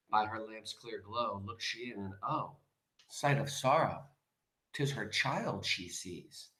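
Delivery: very soft, slowing verse reading; hushed poem recitation. A narrator's reading. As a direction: read it hushed, like a sorrowful discovery.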